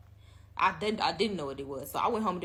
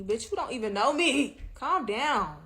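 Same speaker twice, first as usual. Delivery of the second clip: loud, higher snap at the texter; exasperated outburst; 5 semitones higher, 6 dB louder than usual. Real, not acted. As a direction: tell him off loudly. Mocking the texter.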